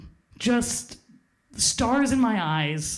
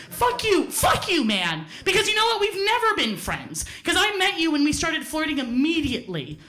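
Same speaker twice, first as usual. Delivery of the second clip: furious retort; 6 semitones higher than usual. Retold or imitated. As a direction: spit it at the boy who friend-zoned you. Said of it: "And I got so mad".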